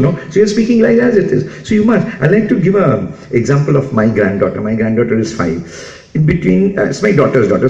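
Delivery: ordinary talk, nothing marked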